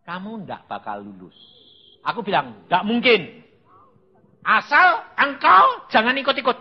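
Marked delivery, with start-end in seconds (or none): 0.0-1.4 low, soft pronouncement; voice of God. Retold or imitated. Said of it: "Lalu Tuhan ngomong bilang apa?". 2.7-6.6 loud, high comeback; defiant retort. Retold or imitated. Memerankan bantahan kepada Tuhan.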